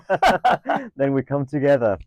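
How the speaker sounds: laughing through the words. Real, not acted. Guest laughing with the host about coming together. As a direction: giggle while you say it.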